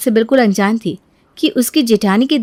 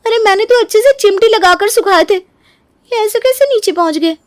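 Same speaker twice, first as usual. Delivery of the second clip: can't believe it happened; bewildered protest; 10 semitones higher than usual. Acted. Neeru to her husband, high and bewildered.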